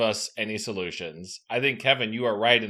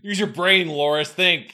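louder, higher mock yell; 5 semitones higher, 5 dB louder than usual. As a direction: bark it at him. Player yelling at Loris.

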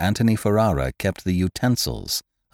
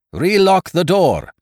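high, slow, loud shouted command; cry out; 12 semitones higher, 6 dB louder, slower than usual. A narrator's reading. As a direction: call it out across the field. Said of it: "came the cry".

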